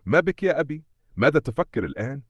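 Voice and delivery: put-on high-pitched little girl questioning her father; childlike tone. A narrator's reading. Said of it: "بنبرة مختلفة، وكأنها عادت طفلة".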